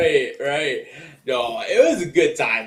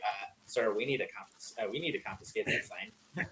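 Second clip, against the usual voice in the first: much softer, lower, hesitant request; discreet usher; 4 semitones lower, 14 dB quieter than usual. Retold or imitated. Usher asking a fan for the sign, quietly. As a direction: imitate an official's low, apologetic aside.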